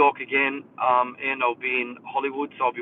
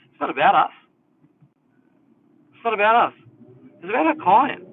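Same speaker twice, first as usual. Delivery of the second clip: higher, louder insistence on "not about us"; emphatic; 8 semitones higher, 7 dB louder than usual. Real, not acted. Giving hairdressers a newsflash, emphatic. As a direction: drive home "not about us", pausing before each repeat.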